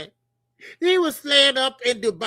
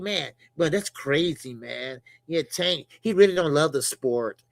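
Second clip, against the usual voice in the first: low, restrained imitation of a proper California speaker; mock-proper West Coast tone, kept low; proper California talk; 7 semitones lower than usual. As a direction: lightly imitate proper L.A. talk, pitched lower. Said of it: "that proper tone".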